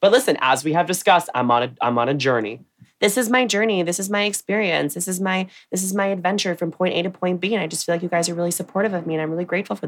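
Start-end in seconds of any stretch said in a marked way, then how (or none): 3.0-9.9 high, gushing YouTube beauty vlogger. Retold or imitated. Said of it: "This is my impression of a beauty vlogger".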